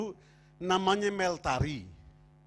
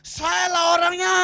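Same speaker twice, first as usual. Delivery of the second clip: very loud, high yell, last word held; sudden full-force cry of volunteering; acted shout; 12 semitones higher, 14 dB louder than usual. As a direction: shout it from the back of the hall. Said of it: "Sekarang tiba-tiba berteriak".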